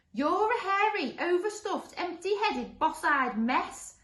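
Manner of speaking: high, loud, drawn-out tirade; high-pitched monster name-calling; shouted monster insults. Acted. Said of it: "shouted the first monster".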